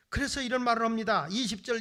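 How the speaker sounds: usual speaking voice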